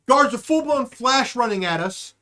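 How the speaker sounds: ordinary talk, nothing marked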